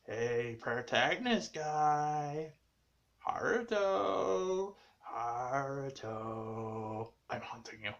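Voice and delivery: spooky ghost. Pretend. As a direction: moan the name like a haunting ghost. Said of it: "You guys gotta bring that back".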